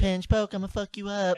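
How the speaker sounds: loud, high mock threat, half laughing. Friend playfully threatening a jinx opponent, loud mock threat. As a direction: bark it out like a playful kid's threat.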